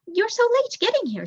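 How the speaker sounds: chatty lady mouse, pitched well up; eager neighbour calling out her surprise; Janice the neighbour. A narrator's reading. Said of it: "she said".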